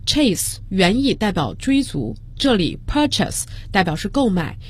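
normal talking voice

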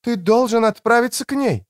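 high-pitched voice for a woman; woman's voice. A narrator's reading. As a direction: pitch it up for the female character. Narrator voices the woman character.

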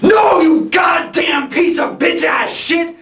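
usual speaking voice

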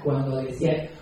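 ordinary talk

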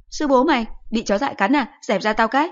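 high, loud irritated snap; a mother telling her son off; shrill-free loud mother scolding. Acted. Mother curses.

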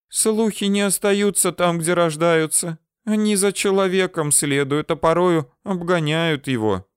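high-pitched female voice, understated despair; doomed resignation. A narrator's reading. Girl tells him rumours follow people, doomed resignation. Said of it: "обречённо произнесла она".

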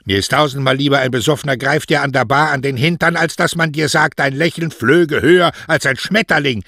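high, loud, outraged maternal tirade; indignant mother, ranting. Acted. Mother rants to her daughter about flatterers, loud and scornful.

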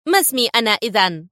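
mildly edgy test question; nervy irritation. A narrator's reading. Commander testing the impostor, edgy. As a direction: demand it with suspicion. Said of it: "أن سأل في عصبية".

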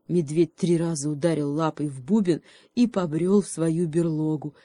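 plain reading voice

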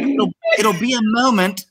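high, girly put-on voice; mock-feminine high voice; polite girls' reply. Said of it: "All the girls are like".

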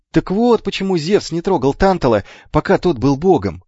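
high, excited realisation; sudden outburst. A narrator's reading. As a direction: burst out with the discovery. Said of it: "вырвалось у Ефикла".

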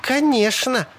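high, breezy woman's voice; lightly cheerful, untroubled; breezy unconcern. A narrator's reading. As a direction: answer high, as if it were nothing. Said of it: "Салли казалась ужасно спокойной, даже весёлой".

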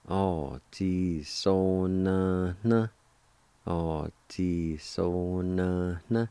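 "O ji so na na." stretched slowly; slow, careful teaching pronunciation; slow demo. Shown as a demonstration. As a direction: draw out every syllable of "O ji so na na.". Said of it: "it's pronounced like this".

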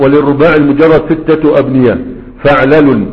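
loud formal reading of the quoted text; قراءة نص المتن. Shown as a demonstration. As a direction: declaim the book's line to the class. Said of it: "قال". (قراءة المتن بصوت مرتفع)

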